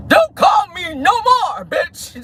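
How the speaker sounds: high, drawn-out mock yell; retold yell. Imitating a woman ending a phone call, high mock yell. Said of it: "she is yelling at his ass in the phone".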